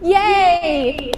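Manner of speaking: excited cheer. Real, not acted. Teacher cheers student's playing.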